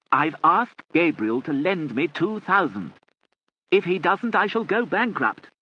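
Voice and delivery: loud and high, drawn out; loud, slow shouting. A narrator's reading. Lord Charles explaining to his deaf aunt, loud and slow. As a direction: lay it out loudly and slowly.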